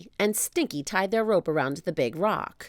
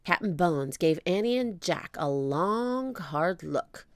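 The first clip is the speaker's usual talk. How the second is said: ordinary delivery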